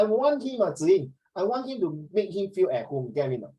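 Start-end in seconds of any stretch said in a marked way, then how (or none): none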